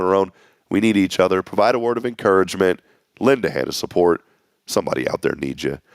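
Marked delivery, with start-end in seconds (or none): none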